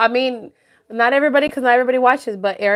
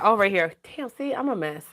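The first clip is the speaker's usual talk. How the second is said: usual speaking voice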